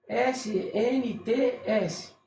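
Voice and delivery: S N T S spelled slowly, letter by letter. Shown as a demonstration. Pointing at the word for the viewer, spelling S N T S slowly. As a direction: read S N T S as separate letters, slow. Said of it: "Olha aqui, ó".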